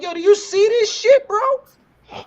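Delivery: high, loud mock disbelief; incredulous. Telling friends how he called Pat about the copycat, incredulous. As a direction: replay it astonished, pitch shooting way up. Retelling his amazed call to Pat.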